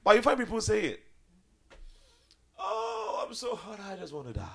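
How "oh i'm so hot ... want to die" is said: whiny complaint. Retold or imitated. Preacher mocks complaining believers.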